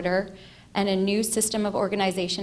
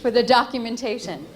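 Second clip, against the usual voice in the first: projected, louder line for the mic; louder mic check; 4 semitones higher, 4 dB louder than usual. Real, not acted. Speaker testing the mic for the recording, projected louder. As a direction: push it out for the recording. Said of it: "I have a pretty loud voice".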